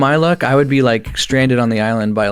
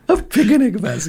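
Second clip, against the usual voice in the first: put-on Japanese accent; 10 semitones higher than usual. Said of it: "in a Japanese accent".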